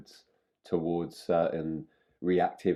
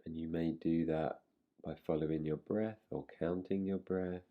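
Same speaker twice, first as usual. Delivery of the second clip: guided meditation; 6 dB quieter than usual. Real, not acted. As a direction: softly settle them in, leaving space between phrases.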